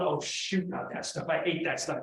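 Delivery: soft, low dismayed grumble; mock dismay. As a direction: imitate someone quietly dreading the task. Acts out Jennifer dreading a task.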